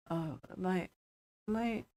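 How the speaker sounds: quiet, hesitant voice jumping from low to high; lightly voiced boy mumbling, pitch slipping upward; mumbling prepubescent boy. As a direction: mumble like a boy whose voice is breaking. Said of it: "it's like a prepubescent voice, goes high and low".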